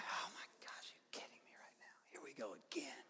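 whispered, fed up and exasperated. Retold or imitated. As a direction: breathe it out like someone tired of the same old fight. Jesus exasperated at Pharisees.

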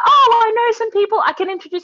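high, loud, imitated eagerness; mock excited big shot; suddenly happy Englishman. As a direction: offer help with hammed-up delight. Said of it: "so sad to so happy".

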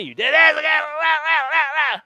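very loud, high imitation of a tirade; mock yelling. Imitating the woman yelling at him at work, loud mock tirade. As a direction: yell it like a ranting coworker, running into blah-blah. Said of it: "she is yelling at me".

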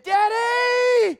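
put-on high, loud desperate kid's cry for help; child pleading. Young son begging daddy to help clean the mess, desperate. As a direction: beg your dad for help like an overwhelmed child. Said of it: "He said".

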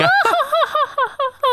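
high, arrogant villain laugh; haughty laugh. Acted. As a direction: cackle with contempt. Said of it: "good cue for Maddie to do a haughty laugh".

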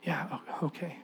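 very soft, hesitant acted reply; meek reply. Dad bracing for the worst from the woman he hurt, meek. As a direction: answer meekly, barely audible. Dad waiting to hear her verdict.

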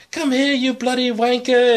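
high, loud mock British old-lady accent. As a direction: play a feisty old British granny. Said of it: "just in her accent".

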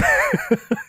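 squealing laugh; high burst of laughter. Real, not acted. Big laugh.

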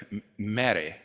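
soft, low British imitation; British accent. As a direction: imitate a hushed British film Jesus. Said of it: "Jesus had a British accent".

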